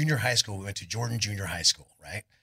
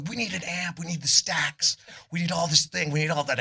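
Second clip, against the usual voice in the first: put-on higher, eager kid pleading for gear; retold eager kid; 8 semitones higher than usual. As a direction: pitch it up like a greedy teen. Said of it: "I go".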